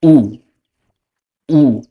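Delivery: ऊ as a pure Spanish "u"; Spanish letter name. Shown as a demonstration. Teacher to students, careful Spanish vowel. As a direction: repeat ऊ clearly. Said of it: "यू को कहते हैं".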